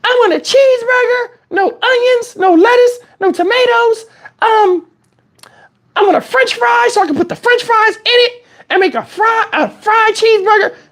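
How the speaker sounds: put-on high, rambling little-girl order. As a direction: play the picky kid reciting her order. Daughter voiced ordering.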